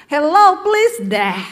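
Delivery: put-on sassy Jakarta teen "Hello, please deh"; Jaksel girl. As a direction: brush it off like a trendy South Jakarta kid. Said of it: "Gaya-gaya anak Jaksel".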